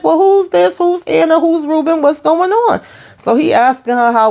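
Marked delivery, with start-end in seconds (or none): 0.0-2.8 excited confusion. Pretend. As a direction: pile on the questions, pitched way up. Recapper confused by new names.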